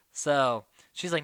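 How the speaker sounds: ordinary talk, nothing marked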